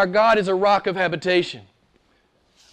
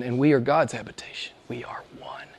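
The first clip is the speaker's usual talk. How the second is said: low hush sinking to a bare whisper; reverent whisper; 8 semitones lower, 10 dB quieter than usual. Real, not acted. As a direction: let it fade into an intimate whisper. Intimate climax on union with God.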